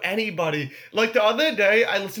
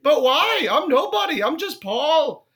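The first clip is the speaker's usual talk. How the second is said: high, louder mock-innocent British star; 6 semitones higher, 4 dB louder than usual. Singer protesting to fans that he is nobody, high put-on voice. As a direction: plead baffled modesty, stretching the last words. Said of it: "this is my impression".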